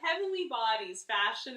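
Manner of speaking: ordinary talk, nothing marked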